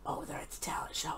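unvoiced hushed whisper; watching a tense scene, whispered. Real, not acted. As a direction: whisper it under your breath.

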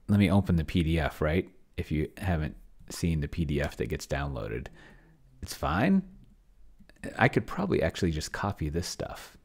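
normal talking voice

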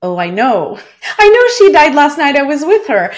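high, loud burst of laughter through the words; laughing disbelief. Retold or imitated. Telling her colleague she already knew, laughing. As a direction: laugh it out like it's absurd to say. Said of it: "how to even say to her, like".

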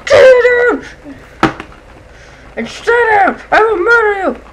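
loud, high screaming rage; furious shout. Real, not acted. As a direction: scream the threat at the enemy. Raging at a game enemy.

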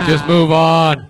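loud, slow mock heckle; impatient crowd. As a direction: imitate an audience calling out, stretched out. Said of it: "where they're just like".

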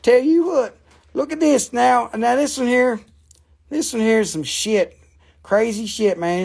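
high-pitched, hammed-up Southern accent; switched Southern accent. Shown as a demonstration. Seller showing off an accent switch, then pitching a rock, Southern accent. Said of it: "then I switch, man, I start talking like this".

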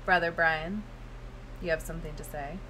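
low, soft retold question; gentle prompting, imitated. Woman turns to Brother Brian in the silent room, low and soft.

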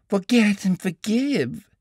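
high, slow, impassioned woman's voice; passionate cry. A narrator's reading. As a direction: beg him passionately, drawn out. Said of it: "she cried passionately".

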